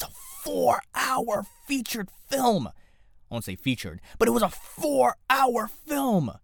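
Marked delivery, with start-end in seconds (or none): 0.0-2.7 high-pitched, incredulous exclamation; exasperated outburst. Real, not acted. Podcast host ranting to listeners about film length, exasperated outburst. 4.4-6.4 drawn-out, high incredulous cry; exasperated outburst. Real, not acted. Host correcting himself mid-rant about film length, exasperated outburst.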